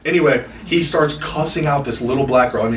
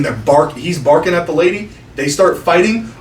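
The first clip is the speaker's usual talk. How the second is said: ordinary talk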